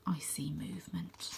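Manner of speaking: ordinary delivery, nothing marked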